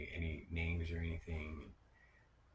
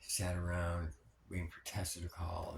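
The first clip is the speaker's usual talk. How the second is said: normal talking voice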